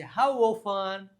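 "often" with a silent t, drawn out slowly. Shown as a demonstration. Teacher showing "often" is said without the t. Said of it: "silent છે".

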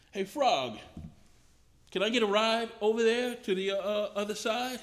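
scorpion asking a favor. Acted. Scorpion in the fable asking the frog for a ride, sly. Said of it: "And he says".

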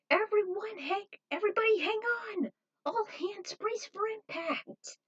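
high, slowing, alarmed call to brace; urgent warning. Acted. Victoria warns everyone aboard before the crash, alarmed.

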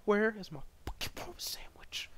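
hushed, voiceless complaint; mock whispered rant. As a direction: hiss it under your breath.

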